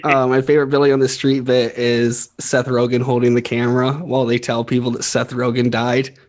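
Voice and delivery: normal talking voice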